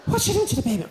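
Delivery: high-pitched mock outrage; son going mad. Son aghast at breastfeeding at the next table, going mad. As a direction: squeal it like a scandalised son, hammed up. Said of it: "he went mad".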